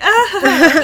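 loud, high "ah" through laughter; hammed-up cry of dismay; mock dismayed cry. Mimics character's dismayed reaction.

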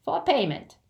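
high and insistent; firm goblin. Acted. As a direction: state terms as an unbending goblin. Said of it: "said the goblin firmly".

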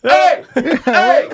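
loud angry yell from upstairs; shouting. Retold or imitated. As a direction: yell it out, annoyed. Neighbour yelling about the air horn.

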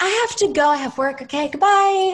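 high, sing-out excuse to escape; overdone, panicky polite exit; mock hasty goodbye. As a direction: blurt a high-pitched goodbye and flee.

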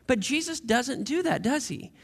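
ordinary talk

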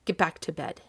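plain reading voice

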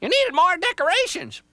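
very high, loud squawky bird; an alarmed jaybird blurting a warning; frantic bird voice. Acted. Jaybird warns Little Tree of the axe, high squawk.

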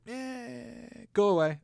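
high, put-on dismissive voice; rabbi waving off. Rabbi turning away a convert, high and dismissive. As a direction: shoo him off with a high scoff. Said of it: "And they're like".

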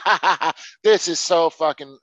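sarcastic mock guffaw; fake audience laugh. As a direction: laugh like a dim viewer.

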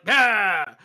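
loud, drawn-out mock roar; imitated crowd cheer. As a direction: answer as the crowd, long and loud.

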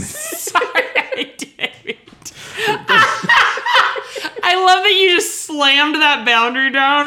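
high, helpless laughter through the words; real laughing fit. Real, not acted. Told off for a foot touch, helplessly laughing.

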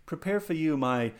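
high, swooping hipster mimicry; mock pretentious idiot. Hammed-up idiot proudly presenting a dish, mocking pretentious delivery. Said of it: "this goddamn idiot ... was like".

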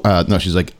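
normal talking voice

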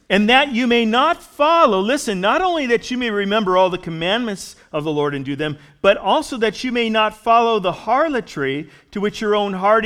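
projected preacher's voice, punching the key phrases; emphatic scripture reading. Real, not acted. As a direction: proclaim the verse to the congregation. Reads scripture aloud at force.

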